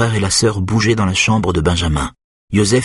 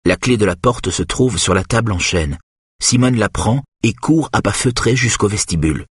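ordinary delivery, nothing marked

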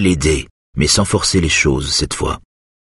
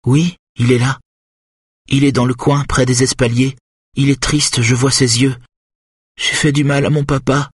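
put-on high childlike voice, sad and wondering; small child in trance; 8 semitones higher than usual. Hypnotized Charlotte tells the therapist about her papa, childlike and sad. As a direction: speak as a little girl seeing her sad papa.

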